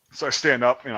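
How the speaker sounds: usual speaking voice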